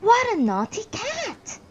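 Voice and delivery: high, slow mock reproach; scolding mistress. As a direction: tut at the cat like a prim lady. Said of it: "designs to remark".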